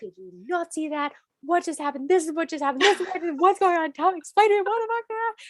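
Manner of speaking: high, rising, near-tears pleading; hysterical. Retold or imitated. Begging Phil to explain, rising to near tears. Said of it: "I start bawling".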